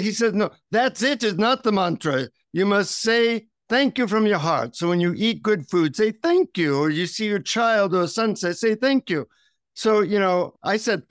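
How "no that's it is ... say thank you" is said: imitated Indian guru, pitched up; higher, lilting Indian-accented instruction. Guru correcting his visitor on the mantra, Indian accent. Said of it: "in his deep Indian accent".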